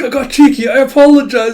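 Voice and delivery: high, loud, hammed-up pleading; panicked apology. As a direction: beg forgiveness in mock panic. Cowed character apologizes in panic.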